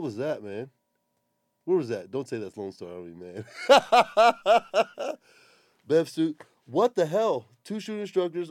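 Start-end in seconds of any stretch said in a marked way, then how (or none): 3.5-5.1 high, loud incredulous laugh; burst of laughter. Real, not acted. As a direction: laugh out at how bad it looks. Reacting to bad skydive video.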